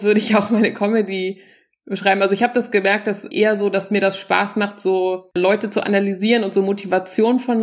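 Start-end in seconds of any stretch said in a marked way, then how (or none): none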